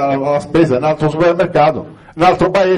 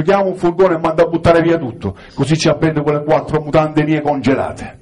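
ordinary talk

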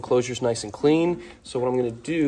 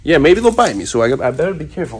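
louder, fast swaggering bravado; mock-brave taunt at fat lizards, louder and quick; mock tough talk; 2 semitones higher, 6 dB louder than usual. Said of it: "I don't know why I'm talking like this".

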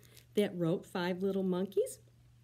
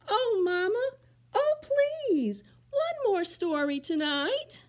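very high, whiny plea for one more story; begging monkeys; 13 semitones higher, 7 dB louder, slower than usual. Acted. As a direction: plead like a little kid at bedtime.

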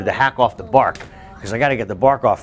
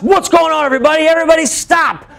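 higher, louder call to stop; neighbor calling out; 4 dB louder than usual. Retold or imitated. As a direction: halt the whole yard with one call. Neighbor breaks up chase.

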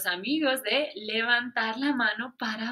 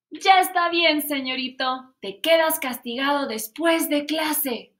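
stern teacher punishing; 3 semitones higher, 6 dB louder than usual. Acted.

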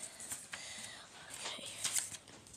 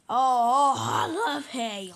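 loud moan, then slow savoring; hammed-up pretend delight; blissful food swoon; 4 dB louder than usual. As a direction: swoon over delicious hay. Cow swoons over hay.

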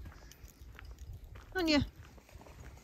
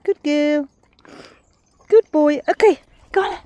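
dog praise; 4 semitones higher, 13 dB louder than usual. Real, not acted. Praising dogs on the walk.

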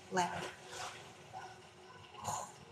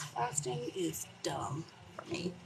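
whispering; slower than usual. Real, not acted. Whispered aside.

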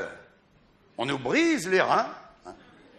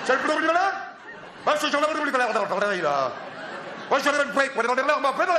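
higher, cartoonish plumber on the phone; comic plumber phone exchange, higher; mock phone call; 5 semitones higher, faster than usual. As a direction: ham up a garbled phone chat.

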